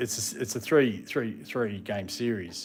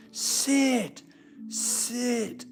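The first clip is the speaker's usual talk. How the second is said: much higher German-accented mock yell of sit; 14 semitones higher, 4 dB louder than usual. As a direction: imitate a German lady yelling at her big dogs. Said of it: "she had a German accent".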